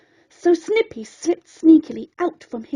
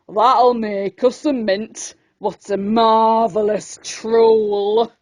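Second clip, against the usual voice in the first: lower, slow, gruff grinning fisherman; gleeful fisherman; 7 semitones lower, slower than usual. Acted. Fisherman gloating over his haul, low and pleased. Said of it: "The fishermen grinned".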